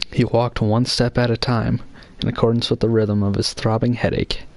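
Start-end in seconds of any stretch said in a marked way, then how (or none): none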